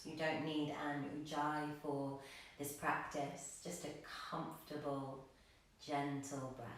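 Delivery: soft, soothing meditation voice with long, restful pauses; guided meditation. Real, not acted. Yoga teacher inviting gratitude into the practice, soft guided meditation. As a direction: settle them into stillness, quietly and without hurry.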